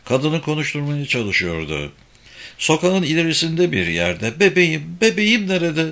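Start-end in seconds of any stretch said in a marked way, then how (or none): 4.4-5.9 put-on high-pitched mother calling for her baby; desperate plea in a raised pitch; frantic cry. A narrator's reading. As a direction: cry out high and pleading, without full volume. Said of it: "diye bağırdı başka bir kadın".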